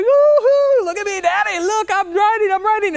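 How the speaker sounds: put-on very high, excited child's calling; gleeful child. Daughter riding alone calls to Daddy, gleeful high shout. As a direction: squeal it out as a triumphant little girl.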